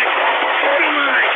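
high, loud drawn-out scream; furious screaming. Real, not acted. Host demands the mic in a rage, screaming. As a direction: scream the demand at full force. Host explodes after mocking fans.

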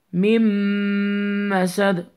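من مسد chanted slowly with a long nasal m, no n; ghunnah. Shown as a demonstration. Teacher shows what it becomes, nasal merged من مسد. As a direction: hum through the join in من مسد. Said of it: "Pronounce it with غنة".

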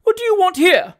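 high, loud, frightened demand; outraged lady. A narrator's reading. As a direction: demand it shrieking at the intruder. Frightened lady challenges the intruder.

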